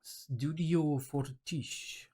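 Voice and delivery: "Studio Fortiche" in a French accent, very slow, soft and drawn out; careful French accent. Shown as a demonstration. Retrying the studio's name, in a slow, careful French accent. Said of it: "I need to get, uh, into this, uh, French accent".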